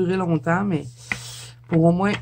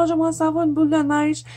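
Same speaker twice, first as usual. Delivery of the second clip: a light, quick, higher retelling of her own wish; imitating her own wistful wish; 8 semitones higher, faster than usual. Mother retelling her shop wish to viewers, lightly wistful and higher. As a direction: chirp it like a wishful kid in a store. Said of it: "je disais tout le temps".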